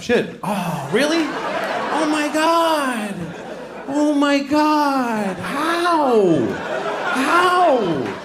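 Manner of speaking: high, stretched mock gasp of disbelief; mock astonished gasp. Comic hearing old news, with high mock gasping disbelief.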